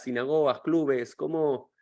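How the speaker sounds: normal talking voice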